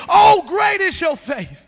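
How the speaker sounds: loud, slow scripture proclamation; proclaiming. Acted. Preacher reads Jesus answering the woman, loud proclamation. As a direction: ring it out slowly to the congregation. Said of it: "Jesus answered the woman and said".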